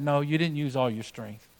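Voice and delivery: daddy speaking quietly and gently; a father lowering his voice to teach; soft fatherly correction. Retold or imitated. Daddy gently telling his son he never asked for help, quiet and tender. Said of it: "And his daddy said".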